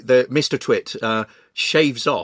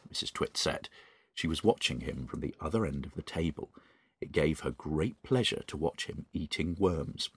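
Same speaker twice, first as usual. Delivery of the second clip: quiet, soft narrator voice; story narration; 5 semitones lower, 12 dB quieter than usual. A narrator's reading. Host reading The Twits aloud, soft narration. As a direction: drop back down to the gentle reader.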